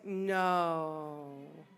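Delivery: long, low drawn-out no; dread. Retold or imitated. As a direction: let the word sink slowly in dread. Dread on hearing the shower.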